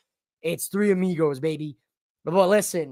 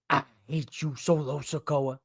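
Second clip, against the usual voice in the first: a quieter, lightly voiced take on Fatu; Jacob Fatu impression; 3 semitones lower, 7 dB quieter than usual. Wrestler to Solo, subdued mild impression. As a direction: mutter it low and brooding. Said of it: "You know it's coming".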